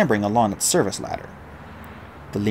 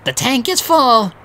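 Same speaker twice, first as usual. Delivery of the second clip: squeaky, slow, much higher; helium voice; 12 semitones higher, 7 dB louder, slower than usual. Acted. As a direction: squeak out the words slowly. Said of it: "he said in a helium voice".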